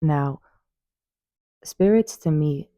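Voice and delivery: normal talking voice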